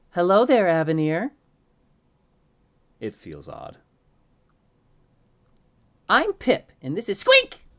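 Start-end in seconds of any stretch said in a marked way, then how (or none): 0.1-1.3 high, loud sing-out greeting; eerie twins in unison. A narrator's reading. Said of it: "And in unison, they both say". 6.1-7.7 put-on very high, loud childlike twins; overly excited. A narrator's reading. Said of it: "Squeak seems overly excited to".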